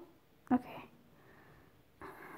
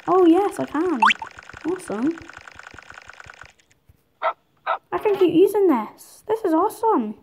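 louder and higher, thrilled the trick works again; excited; 6 semitones higher, 6 dB louder than usual. Real, not acted. Kid gamer thrilled his dog trick keeps working, excited. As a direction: cheer like you just found a cheat.

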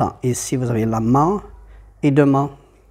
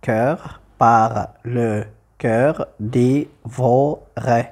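very slow, separated syllables; measured syllable-counting delivery; much slower than usual. Shown as a demonstration. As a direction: scan the word out one syllable at a time. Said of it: "Et j'obtiens donc un, deux, trois".

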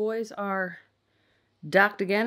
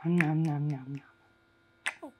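whispered noms; 5 semitones lower, 4 dB quieter than usual. Real, not acted. Playful eating noise.

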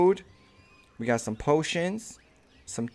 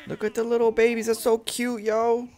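excited gushing; 6 semitones higher than usual. Real, not acted. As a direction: coo at the tiny dinosaurs, thrilled. Gushing over baby dinosaurs.

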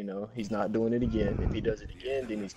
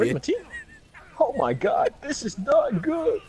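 panicked; 13 semitones higher, 6 dB louder than usual. Real, not acted. Team wiped, panic.

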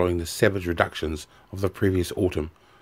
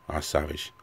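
ordinary delivery, nothing marked

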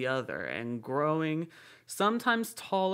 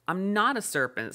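higher, offended denial with a firm NOT; indignant; 6 semitones higher, 3 dB louder than usual. A narrator's reading. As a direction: protest like an insulted girl. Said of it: "said Alice indignantly".

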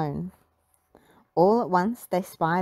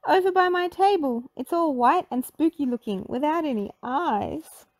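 Miss Pinchface voice; 8 semitones higher than usual. A narrator's reading. Miss Pinchface telling the kids what she saw, high and shaken. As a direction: describe the spooky thing in alarm. Scared cook describes monster.

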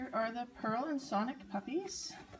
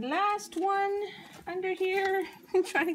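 high cooing; 8 semitones higher, 4 dB louder than usual. Real, not acted.

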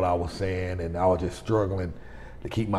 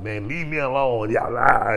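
irritated, higher-pitched retold protest; popping off; 4 semitones higher than usual. Patient to his wife while confused, popping off irritably. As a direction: imitate himself testily popping off. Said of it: "I just kept popping off at the mouth".

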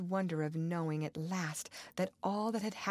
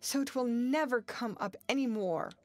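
higher, urgent, panting plea; panted urgency; 6 semitones higher, 4 dB louder than usual. A narrator's reading. Girl proposing they never come back up, urgently panting. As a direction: press on urgently after the panting. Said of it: "she panted".

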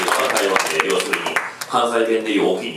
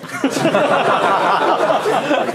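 big laugh; 6 dB louder than usual. Real, not acted. Laughs at being outed.

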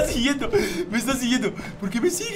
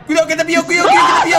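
panicked shout; 3 semitones higher, 5 dB louder, much faster than usual. Real, not acted. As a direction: yell a panicked warning. Chased by a creature, warning friend.

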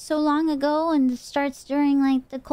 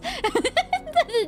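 high laugh. Real, not acted. Laughs at own story.

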